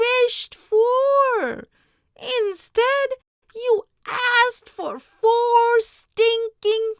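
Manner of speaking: high, loud, furious scolding; shrill screaming fury at him; enraged wife. Acted. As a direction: rage at the foolish husband. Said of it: "his wife flew into a rage".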